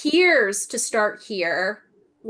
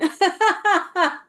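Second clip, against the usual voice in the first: high burst of laughter; 10 semitones higher, 5 dB louder than usual. Real, not acted. Amused by guides' joke.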